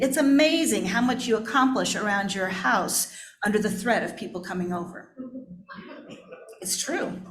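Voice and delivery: ordinary delivery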